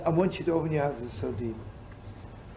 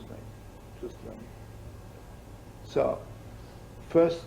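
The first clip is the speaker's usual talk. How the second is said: ordinary talk, nothing marked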